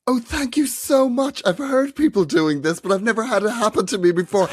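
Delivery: high-pitched, gushing thanks from an imitated old man; grateful old man. Old man thanking a stranger for breakfast, imitated high and gushing. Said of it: "he was really, really grateful".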